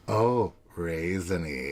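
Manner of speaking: low, slow mocking Hoda. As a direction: lightly mimic her drawn-out raisiny. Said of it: "making fun of me for saying raisiny".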